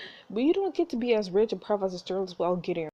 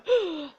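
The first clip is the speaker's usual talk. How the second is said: excited gasp; 10 semitones higher than usual. Real, not acted. Player excited about lighthouses.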